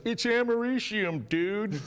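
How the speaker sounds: heckler voice. Retold or imitated. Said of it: "stands up at the end and goes".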